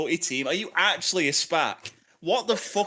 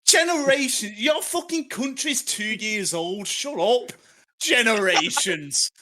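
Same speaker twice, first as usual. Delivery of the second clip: raised, incredulous mockery; scornful retort; 5 semitones higher, slower than usual. Real, not acted. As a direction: throw the word back in scorn. Throws rival's word back in banter.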